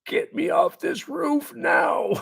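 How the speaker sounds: high, slow, strained mock plea; near-tears panic. Scared roofer begging his boss for rescue, imitated tearful panic. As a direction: act out a drawn-out, terrified high wail. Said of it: "I'm near in tears at this point".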